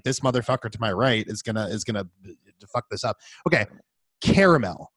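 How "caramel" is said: ordinary delivery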